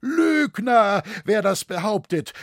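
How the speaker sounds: high, loud outraged shout; furious cry at full pitch; beside himself, screaming. A narrator's reading. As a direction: explode at the accusation. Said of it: "schrie der Kämmerer außer sich".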